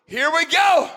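high, loud cry of eager faith; Abraham. Acted. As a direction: burst out ready for it. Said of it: "Abraham laughed like".

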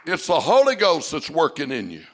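rising preacher's call; preaching. Acted. Pastor urging the church toward the altar, preaching. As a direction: preach it to the congregation.

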